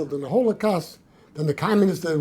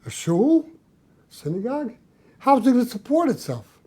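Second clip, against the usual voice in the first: higher-pitched, lightly voiced questioning; the Rebbe; 6 semitones higher than usual. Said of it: "the Rebbe says".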